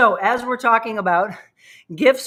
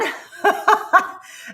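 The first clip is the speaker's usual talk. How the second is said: breathless laugh; 10 semitones higher, 3 dB louder than usual. Real, not acted. Laughs after hard exercise move.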